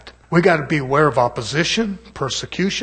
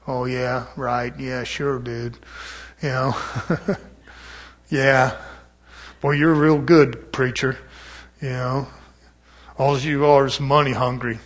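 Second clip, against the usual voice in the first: imitating a doubter scoffing under his breath; sarcastic mutter; 4 semitones lower than usual. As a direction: mumble it like an unimpressed skeptic. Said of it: "the guy sitting next to him's like".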